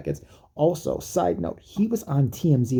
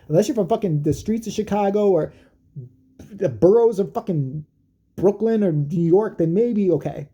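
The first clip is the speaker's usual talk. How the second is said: normal talking voice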